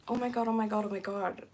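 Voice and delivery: ordinary talk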